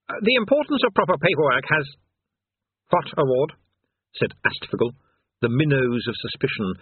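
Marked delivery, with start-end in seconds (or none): 0.1-3.5 high-pitched pompous bluster turning suspicious; flustered demon king. A narrator's reading. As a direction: start speechifying high, then break into a query. King blusters, then questions.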